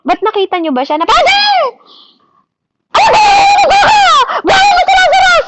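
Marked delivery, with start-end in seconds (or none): 1.1-5.4 very high, loud shouting at the Father; mock screaming prayer. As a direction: scream at God impatiently, way up high. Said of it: "Hindi naman siya ganun magdasal". (nagsisisigaw na panalangin)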